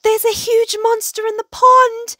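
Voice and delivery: high, loud frightened shout; acted terrified yelling; panicked cry. A narrator's reading. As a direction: cry out the alarm. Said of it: "he cried".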